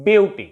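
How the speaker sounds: "beauty" with a strong first syllable; BEAUty, falling at the end; stress on first syllable. Shown as a demonstration. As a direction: hit BEAU in "beauty", drop -ty. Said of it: "First syllable will be stressed".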